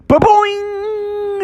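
high springy cartoon boing; boing sound effect. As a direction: make a high, ringing hop noise. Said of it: "But she went".

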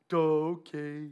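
slow, lightly dim-witted voice; mock dopey reply. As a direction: drag it out like a clueless fisherman. Mimics clueless disciples' reply.

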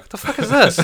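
very high, laughing what-the-hell; laughing disbelief. Retold or imitated. Guy receiving the odd text, high laughing disbelief. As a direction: crack up while pretending confusion. Imagined confused recipient.

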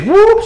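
high, quick vocal whoop; imitated whoop. Preacher mimics her skirting into religion, high whoop. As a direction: whoop like a sudden swerve.

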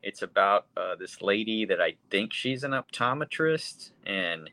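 ordinary delivery

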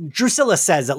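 normal talking voice

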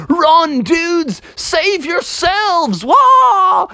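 very high, stretched-out alarm cry; panicked ghost. Acted. Ghost warning bears as the house collapses, high panicked cries.

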